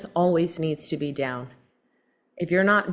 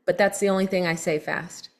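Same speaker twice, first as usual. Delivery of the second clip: ordinary talk, nothing marked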